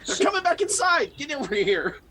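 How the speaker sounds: urgent shout. Acted. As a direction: yell to hurry her over.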